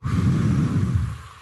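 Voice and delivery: long audible exhale before self-talk; slow exhale as a calming demo; deep sigh. Shown as a demonstration. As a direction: let out a big breath to release tension. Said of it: "So it's like".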